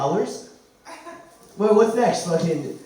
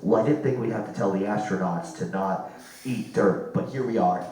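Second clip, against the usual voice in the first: mock mission control; 7 semitones lower than usual. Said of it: "It's like".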